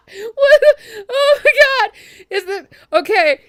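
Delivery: high, loud, breaking off; stunned disbelief. Real, not acted. Player reeling from the surprise entrance, stunned. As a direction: sputter in high-pitched shock.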